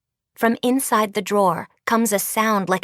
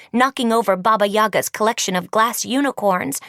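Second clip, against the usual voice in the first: ordinary delivery, nothing marked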